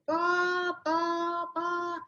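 high nonsense bah bah, ranting trailing off; mock ranting noises. Wife mimics husband's rant turning to noise, mocking. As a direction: gibberish of an angry rant. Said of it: "he started yelling at me".

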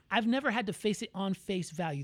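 normal talking voice

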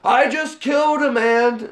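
high, loud, stunned blurt; shocked exclamation. Retold or imitated. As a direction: gasp it out in disbelief. Acted quote of Arnold's character.

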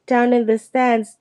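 plain reading voice

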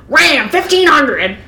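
high, loud, slow ad shout; drawn-out hammed-up truck ad; car commercial announcer. Reader imitating car commercials, booming announcer. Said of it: "I was thinking of car commercials".

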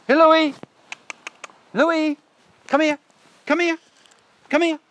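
loud, high-pitched call to a dog; urgent repeated dog recall, loud and high. Real, not acted. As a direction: shout sharply and high to call your dog back. Calling a dog back outdoors.

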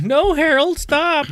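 high-pitched mock pleading wife; protective wife impression. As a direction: put on a high voice as the wife stepping in. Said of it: "his wife jumps in and protects him".

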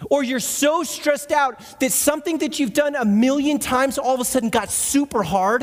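ordinary talk, nothing marked

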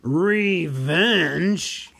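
loud, high snort of scorn; a dragon scoffing the word back, loud. Acted. Dragon snorts with scorn at the hobbit. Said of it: "he snorted".

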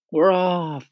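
high, loud cheer; crowd cry. A narrator's reading. The others echoing the centipede, high loud cry. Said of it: "the others cried".

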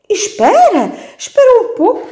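put-on family begging the baby to wait, high alarmed cry. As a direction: cry out to stop him. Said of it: "disseram todos".

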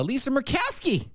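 high-pitched, exaggerated name; mocking name-call. Host naming the senator, mocking high voice.

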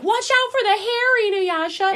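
hammed-up urgent warning to Inuyasha; mock warning call. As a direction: call out to warn him. Kaede warning him.

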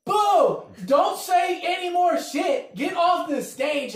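high, loud, slow jeering shout; mock shouting crowd. The crowd shouting at the comic, loud slow jeer. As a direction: shout them off the stage. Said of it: "they're like".

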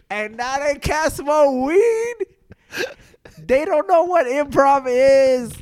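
high, loud, drawn-out wail of protest; mock-anguished whine, stretched out and rising high; exasperated complaint. Recounting exasperated protest to Cypher.

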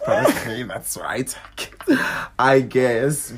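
ordinary talk, nothing marked

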